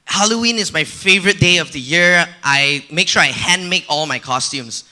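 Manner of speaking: usual speaking voice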